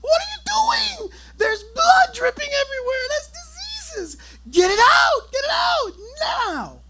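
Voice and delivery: high, loud, panicked shrieking; screaming wife. Retold or imitated. Wife screaming at husband over the bloody rat. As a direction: shriek at him to get the rat out. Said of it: "she's screaming".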